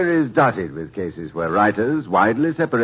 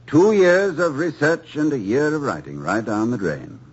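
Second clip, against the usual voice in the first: usual speaking voice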